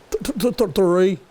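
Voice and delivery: high voice stuck on th before three; stuttering child. Retold or imitated. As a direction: stammer into the number. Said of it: "he kinda stutters a little bit".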